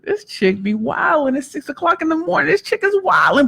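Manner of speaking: high, soft mock disbelief; imitating bemused clients. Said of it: "And they're like".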